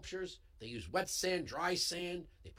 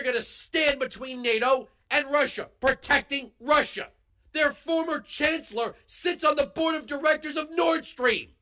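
loud, higher, angry outburst; heated yell; 8 semitones higher, 7 dB louder than usual. Real, not acted. Host yelling to listeners about Germany's Nord Stream, heated yell. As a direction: yell it out, fired up and furious. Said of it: "I know sometimes when I yell".

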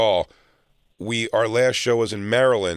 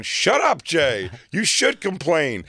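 mock yell; 6 semitones higher, slower than usual. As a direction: bark it at him like a bully. Friends bullying him to complain.

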